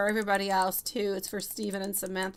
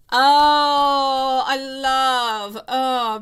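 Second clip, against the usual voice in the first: high, loud delighted gush; excited squeal; 5 semitones higher, 11 dB louder, much slower than usual. Real, not acted. Tarot reader gushing over a viewer's message, excited squeal.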